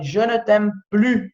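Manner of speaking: "Je ne t'aime plus" slowly, "plus" as "plu"; slow model: "Je ne t'aime plus", no S; slow French example. Shown as a demonstration. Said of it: "olha só a negativa". ("Je ne t'aime plus" devagar, sem o S do "plus")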